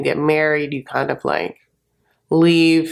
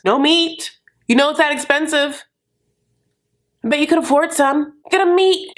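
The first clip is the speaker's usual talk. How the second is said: exasperated high rant; 9 semitones higher than usual. Real, not acted. Ranting about guests bringing nothing.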